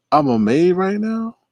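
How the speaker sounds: high-pitched, disbelieving echo; incredulous. Real, not acted. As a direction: question it high, as if it makes no sense. Repeats message in disbelief.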